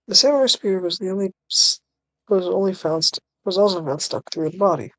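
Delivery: TV news voice. Acted. Newsreader announcing an actor's arrest to viewers, lightly put-on TV news voice. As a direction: read it out like a TV news report. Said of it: "you wanna be the T V voice?".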